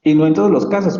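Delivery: ordinary delivery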